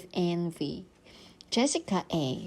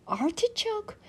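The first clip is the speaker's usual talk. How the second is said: much higher, puzzled repeat of Artichoke; baffled echo; 13 semitones higher than usual. Acted. Judy repeats Jessica's spelling word, stunned.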